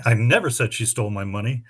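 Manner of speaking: "never" punched, the rest brisk; quick line leaning on never; stress on never. Shown as a demonstration. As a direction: hit the word never hard. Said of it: "read that sentence with seven different emphases".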